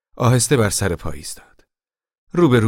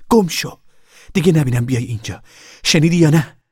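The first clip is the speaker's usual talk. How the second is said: raised, higher-pitched threat; loud angry order; 9 semitones higher, 7 dB louder than usual. A narrator's reading. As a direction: drive her off with a loud warning. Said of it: "با صدای بلند گفت". (با صدای بلند و تهدیدآمیز)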